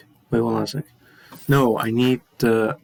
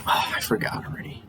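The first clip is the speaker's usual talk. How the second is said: whispered to herself; whispering; 6 dB quieter, much faster than usual. Real, not acted. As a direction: mutter it under your breath. Muttering to herself.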